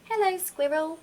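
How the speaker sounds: put-on baby owl greeting a friend; little owl voice.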